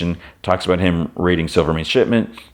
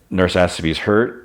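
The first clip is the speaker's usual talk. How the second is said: ordinary talk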